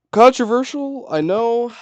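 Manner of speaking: playful mock-defensive aside. Bracing for backlash over choice.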